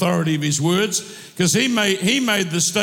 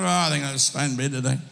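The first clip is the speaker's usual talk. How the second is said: tired groan; 7 semitones lower, 4 dB quieter, faster than usual. Retold or imitated. Sore knee, reluctant to rise.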